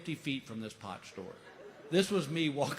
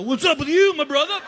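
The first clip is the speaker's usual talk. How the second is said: forced cool greeting; 11 semitones higher, 13 dB louder than usual. Retold or imitated. Trying too hard to sound cool.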